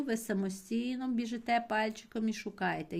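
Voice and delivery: ordinary delivery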